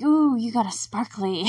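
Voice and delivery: mock Jeremy voice. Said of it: "he's like".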